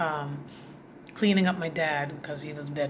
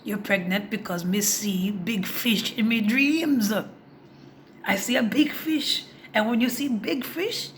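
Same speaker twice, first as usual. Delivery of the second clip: put-on Jamaican accent; 5 semitones higher, 5 dB louder than usual. Father explaining his dream to his daughter, Jamaican accent. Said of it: "He's Jamaican".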